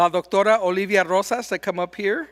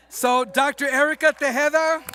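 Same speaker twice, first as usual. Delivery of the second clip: raised, louder presenter's call; announcing; 5 semitones higher, 6 dB louder than usual. Real, not acted. Host announcing the first awardee to the audience, calling out. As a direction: proudly call the winner up over the room.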